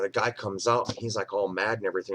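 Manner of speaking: ordinary talk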